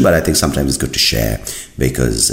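usual speaking voice

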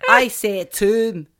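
put-on slow, broad Scottish accent on "tomb". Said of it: "my very best Scottish accent".

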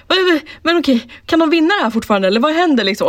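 high-pitched, hurried, worked-up questioning; mock anxious excitement. Viewer getting hooked as penalties start, excited and high-pitched. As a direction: re-enact getting sucked into the suspense. Retold reaction as penalties begin.

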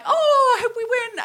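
high-pitched girlish team cheer, played up; mock gush with a long, high "oh"; gushing excitement. Girl imagining new friends on the bus, gushing. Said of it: "we'll all be like".

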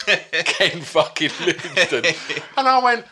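high, loud laughter through the words; can barely get the name out for laughing; laughing hard. Real, not acted. Roaring at friend's name mix-up.

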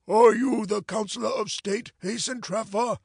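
usual speaking voice

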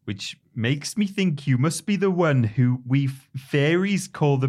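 put-on loud, high, slow Welsh accent slipping into Geordie; bad Welsh character voice, drawn out and high; attempted Welsh accent. Co-host attempting Mister Drippy on request, wobbly Welsh accent. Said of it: "do an impression of Mister Drippy".

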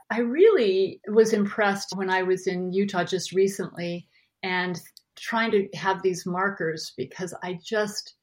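ordinary talk

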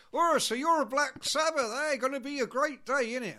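loud, high, chirpy West Country; over-friendly West Country. Acted. Drummer making friends with the band, chirpy West Country accent. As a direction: gush matily in West Country. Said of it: "[name] is being all friendly".

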